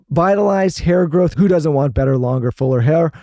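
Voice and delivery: loud, projected sponsor read with punched keywords; podcast ad read. Real, not acted. Host pitching a colostrum sponsor to podcast listeners, emphatic ad read. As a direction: sell it energetically, hitting each benefit.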